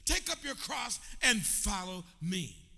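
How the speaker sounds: high and loud, shouting the call to follow; full-force preaching. Acted. Preacher to congregation, shouting that they must follow. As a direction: drive it home to the crowd. Preacher voicing Jesus' demands.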